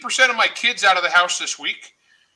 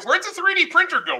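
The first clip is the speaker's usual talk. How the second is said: tag mock puzzlement; 12 semitones higher than usual. Acts out a puzzled questioner.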